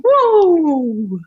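high eerie whoop; spooky whoop. A narrator's reading. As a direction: whoop like a wild witch arriving. Spooky arrival of Baba Yaga.

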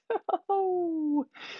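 high, playful chuckle; knowing laugh. Real, not acted. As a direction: laugh at the grim twist. Narrator laughs at the twist.

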